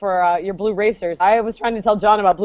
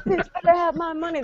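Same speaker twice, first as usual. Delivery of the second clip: softer, higher-pitched mock threat; talking snake; 7 semitones higher, 6 dB quieter than usual. Guest echoing the money-hungry snake, playful threatening voice. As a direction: play a loan-shark snake, quietly. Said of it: "that talk like that".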